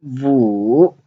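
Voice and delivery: dipping Mandarin tone on Wu; third tone. Shown as a demonstration. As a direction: draw Wu down and swing it back up. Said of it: "the third tone".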